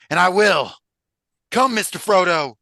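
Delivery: high, loud Southern cry; Sam. Acted. Hobbit urging his friend onward, crying out in Southern voice. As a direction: cry out with testifying fervor. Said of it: "he cried".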